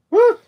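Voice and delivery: short, loud, high bark noise; quick yipped reply from a pet; dog's woof. Acted. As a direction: imitate a dog's single woof. The dog Nellie answers.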